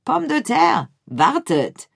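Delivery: calling out. A narrator's reading. The rat calls after his friends lost in the tunnel. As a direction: shout the name after friends who vanished. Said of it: "rief er".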